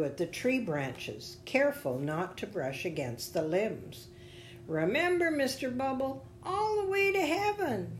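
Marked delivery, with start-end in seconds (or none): 4.7-8.0 put-on high-pitched little girl's voice; little girl calling out. A narrator's reading. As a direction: call up after someone in a high child voice. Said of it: "the little girl called out".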